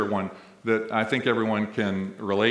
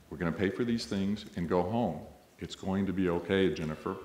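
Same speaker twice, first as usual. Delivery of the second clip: soft, low and soothing, much quieter; very calmly; 5 semitones lower, 8 dB quieter than usual. Retold or imitated. Mother soothing her screaming child in a deli, very calm and quiet. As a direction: lower and softer, steady and unhurried to calm a child. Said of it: "the woman say very calmly".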